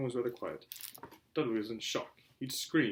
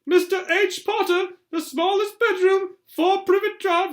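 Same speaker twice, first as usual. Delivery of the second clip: very high, loud shout; boy shouting from the hall about the letter; 13 dB louder, slower than usual. Acted. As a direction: call it down the hall at full voice. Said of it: "Then he shouted".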